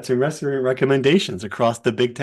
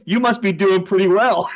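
teasing coach; 9 semitones higher, 4 dB louder than usual. Retold or imitated.

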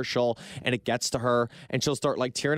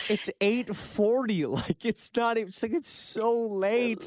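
higher, slow incredulous mocking of "It's eight forty"; 8 semitones higher, slower than usual. Husband mocking why his wife cried, high slow disbelief. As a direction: drag out "so late" in disbelief. Mocking wife's reason to cry.

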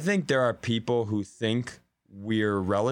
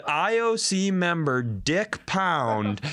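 slow disbelief; 5 semitones higher, much slower than usual. Retold or imitated. Reading the tweet in disbelief.

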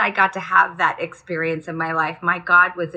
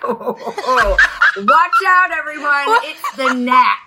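high, laughing mock alarm; mock scandalized; 6 semitones higher, 5 dB louder than usual. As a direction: gasp at showing skin, laughing. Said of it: "I'm like".

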